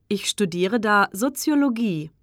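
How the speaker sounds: usual speaking voice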